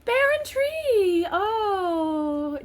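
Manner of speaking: high, delighted cry of discovery; excited cry. Real, not acted. Finding the bear and tree toys, high delighted cry. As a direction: squeal with joy at finding them.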